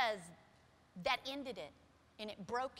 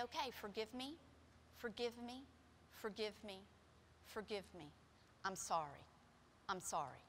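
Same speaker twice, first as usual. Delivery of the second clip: soft, lower, humble apology fading away; humbly; 4 semitones lower, 7 dB quieter than usual. Retold or imitated. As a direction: plead forgiveness quietly, broken. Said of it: "I did it humbly".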